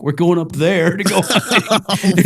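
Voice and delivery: mock the guys' cheerful plan through laughter; laughing, drawn-out mock announcement; laughing quote. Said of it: "They're like".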